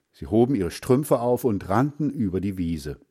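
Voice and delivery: ordinary delivery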